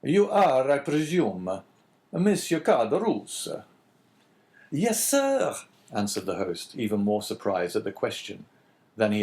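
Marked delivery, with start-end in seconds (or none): 0.0-3.6 priest in a lightly voiced Italian accent; a measured Italian accent, slow on the name; an Italian priest, louder and higher; strong Italian accent. A narrator's reading. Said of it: "speaking with a strong Italian accent". 4.7-5.6 high eager innkeeper voice; much higher servile host. A narrator's reading. Innkeeper voice in story.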